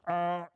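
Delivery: loud car starter grind; grinding car noise. Retold or imitated. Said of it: "makes that noise".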